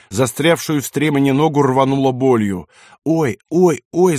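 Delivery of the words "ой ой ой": high, repeated yelps; pained high outcry; crying out in pain. A narrator's reading. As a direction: cry out as blows land. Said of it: "закричал лейтенант".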